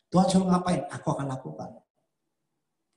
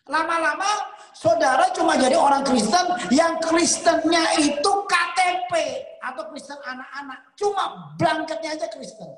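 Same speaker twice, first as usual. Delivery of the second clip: preaching; 7 semitones higher, 5 dB louder than usual. Real, not acted. Preaching at full force.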